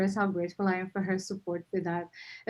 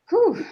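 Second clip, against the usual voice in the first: sharp high blown-out whew, louder; relieved whew; 13 semitones higher, 9 dB louder than usual. Real, not acted. Speaker at the AGM, releasing nerves with a loud whew. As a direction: let out the tension in one loud breath. Exhale after tough speech section.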